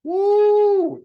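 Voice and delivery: high, loud whoop; cheering woo. Retold or imitated. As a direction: let out a triumphant whoop. Mock celebration cheer.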